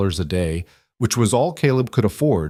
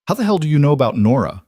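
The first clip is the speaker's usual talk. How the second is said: angry; 6 semitones higher, 4 dB louder, faster than usual. Acted. Angry outburst at stranger.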